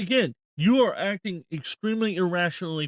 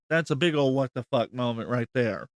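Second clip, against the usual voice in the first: ordinary talk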